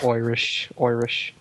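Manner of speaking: mock Irish accent, "Irish" as "Oirish"; exaggerated Irish "Oirish".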